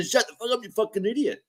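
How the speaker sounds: high mocking yelling voice; imitating a yell. Host mocking Craig yelling over everybody, high mock voice. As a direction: mimic him shouting people down. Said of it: "You just wanna yell over everybody".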